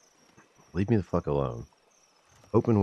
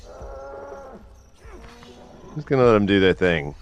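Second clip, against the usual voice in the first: higher, slow, stretched-out put-on voice; drawn-out singsong; 8 semitones higher, much slower than usual. Gamer backing off from a strange scene, drawn-out silly singsong. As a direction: let it hang lazily in a silly voice. Repeats line drawn out, odd voice.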